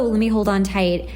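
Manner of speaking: ordinary talk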